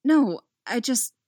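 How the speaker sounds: loud, high start, then break off; frustrated, abandoned protest; cutting herself off. A narrator's reading. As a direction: begin to protest and stop short. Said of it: "she cuts herself off".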